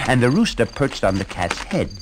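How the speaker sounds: plain reading voice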